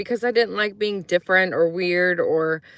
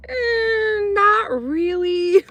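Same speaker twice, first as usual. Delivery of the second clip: very high, slow whiny admission; sheepish kid; 14 semitones higher, much slower than usual. Retold or imitated. Girl caught by friends, high and sheepish. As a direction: squeak it out, caught in a lie. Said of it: "And I'm just like".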